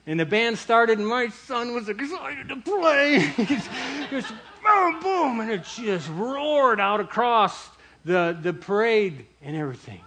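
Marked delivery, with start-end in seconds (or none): 4.7-5.4 loud, high vocal boom; imitated drum boom. Storyteller imitates his son's band starting, booming sound effect.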